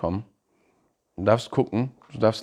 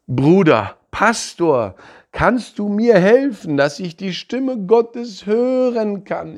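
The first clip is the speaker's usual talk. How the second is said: higher, louder, slowing plea for help; pleading believer; 8 semitones higher, 5 dB louder, slower than usual. Retold or imitated. Believers worldwide asking the pastor for help, higher pleading voice. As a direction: beg the pastor for help, drawn out. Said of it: "die Leute sagen".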